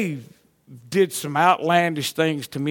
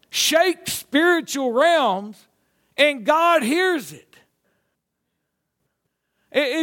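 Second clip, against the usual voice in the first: raised, drawn-out preacher's delivery; fervent preaching; 7 semitones higher than usual. Real, not acted. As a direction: preach it with conviction, pitched high.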